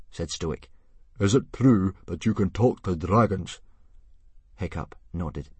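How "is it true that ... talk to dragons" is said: chief asking. Acted. Stoic questioning his son.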